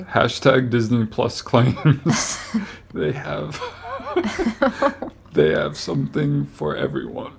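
breaking into giggles mid-sentence; fighting back laughter while reading; laughing. Real, not acted. Reading own joke tweet, giggling.

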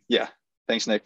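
ordinary delivery

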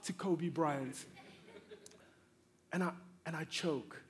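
ordinary talk

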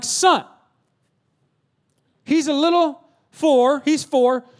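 emphatic exasperated dad; stern scolding. Acted. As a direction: spell it out to your son. Father scolding his older son.

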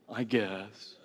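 put-on low, soft teenage indifference; reluctant teenager. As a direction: imitate a teen grudgingly giving in. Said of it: "You know, teenagers".

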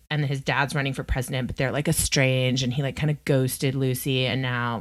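ordinary delivery

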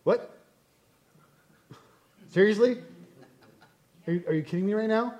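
baffled, disbelieving questions with long pauses; mock incredulity. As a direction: act stunned by a dumb excuse. God reacting to dumb excuses.

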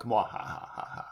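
whispered mwahahaha; breathy villain laugh; evil laugh. Acted. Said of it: "Mine is an evil laugh".

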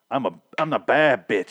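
lightly mimicked Jamaican accent. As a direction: boast with a hint of Jamaican accent. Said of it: "She has, like, Jamaican accent".

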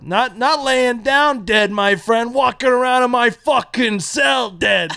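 slow, growing mock-villain quote ending in a shout; corrected line. Fixing the misquoted sheriff line for friends, slow building mock-villain shout. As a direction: redo the sheriff's line properly, building to a yell. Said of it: "I fucked it up".

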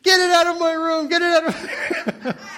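high, loud mock scream breaking into a laugh; imitated scream dissolving into laughter. Mom imitating daughter screaming, ending in laughter. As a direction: scream it as a teen, then crack up. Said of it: "she's screaming at me".

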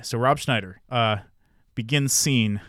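ordinary talk

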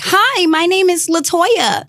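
high, slow, prim office voice; code-switching voice. Retold or imitated. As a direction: put on the polite job-interview voice. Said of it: "you're like".

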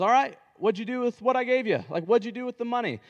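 high, loud, pressing master asking for an account; demanding master. Retold or imitated. Returning manager demands an account from his servants, loud and pressing. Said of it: "and he says".

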